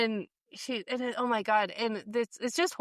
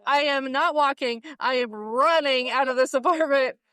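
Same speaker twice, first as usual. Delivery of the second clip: loud, emphatic, pretending to flee; I'm out of here, said with mock urgency; mock panic; 2 semitones higher, 5 dB louder than usual. Fan pretending to flee a scary man, in loud mock panic. Acting out fleeing the apartment.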